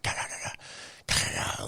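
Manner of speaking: soft unvoiced rapid "ka" bursts for gunfire; whispered gunfire noise. A veteran mimicking distant gunfire in a breathy hush. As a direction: breathe out rounds cooking off. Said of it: "rounds cook off".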